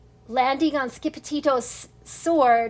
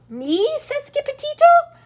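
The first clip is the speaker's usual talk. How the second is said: surprised; 12 semitones higher, 5 dB louder than usual. Acted. Surprised character, high pitch.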